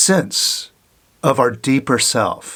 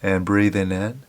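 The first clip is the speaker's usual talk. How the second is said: guided meditation. Acted. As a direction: gently guide them to watch the breath. Leads a breath meditation.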